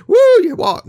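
high, loud whoop; mock cheer. Jesus cheering the healed man's first steps, high loud whoop.

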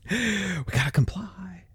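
slow, breathed-out whisper; hushed compliance. Acted. A rule follower accepting the cash law, in a hushed whisper. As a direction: whisper it slowly, as if giving in.

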